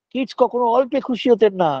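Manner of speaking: ordinary talk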